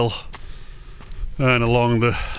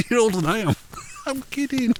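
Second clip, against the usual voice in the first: laughing while joking; teasing with a laugh; 12 semitones higher than usual. Real, not acted. Teasing viewers then laughing.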